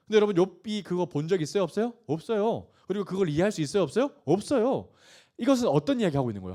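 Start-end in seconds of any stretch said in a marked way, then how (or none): none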